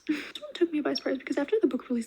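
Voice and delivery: ordinary talk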